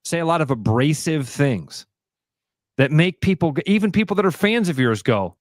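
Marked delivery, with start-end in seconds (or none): none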